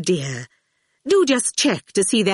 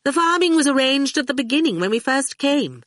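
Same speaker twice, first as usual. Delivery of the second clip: high-pitched, pouty grumble; pouting; 6 semitones higher than usual. A narrator's reading. Dillion tells Howard she got saddled with police business, pouting. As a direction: complain through a pout. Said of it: "Her lovely mouth pouted".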